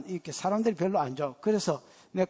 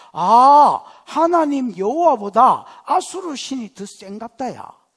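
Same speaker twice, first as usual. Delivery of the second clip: 한탄하는 백성 흉내; 2 semitones higher, 9 dB louder, slower than usual. Retold or imitated. 백성의 한탄을 연기.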